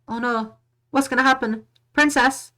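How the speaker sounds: high, loud panicked cry; alarmed cry. Acted. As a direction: shout it out in alarm. Alarmed cry at story twist.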